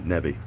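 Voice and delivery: "Neve" said like "never" with no R at the end. Shown as a demonstration. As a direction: say "Neve" as "Nev-eh", "never" minus the R. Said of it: "Never without the R".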